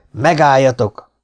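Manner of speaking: loud, drawn-out halt order; commanding shout. A narrator's reading. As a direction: call it out firmly across a hall. Newcomer calls halt from gate.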